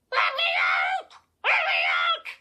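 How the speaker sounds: loud, squawking dummy shout; angry high-pitched voice. A narrator's reading. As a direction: shriek angrily like a tiny trapped creature. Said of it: "the high-pitched voice called angrily".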